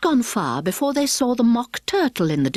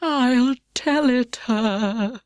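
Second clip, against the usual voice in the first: deep hollow tone; 4 semitones higher, much slower than usual. Acted. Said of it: "in a deep, hollow tone".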